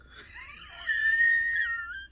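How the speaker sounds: high, loud shrieking laugh; real high whoop of laughter; burst of laughter. Real, not acted. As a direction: let out a big whooping laugh. Laughing at granddaughter's hint.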